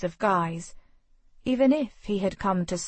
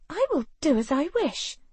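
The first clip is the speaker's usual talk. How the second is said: lightly voiced heroine, pitched up in defiance; a hint of a headstrong lady; defiant young woman; 8 semitones higher than usual. A narrator's reading.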